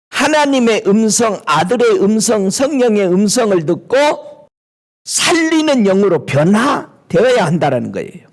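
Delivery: usual speaking voice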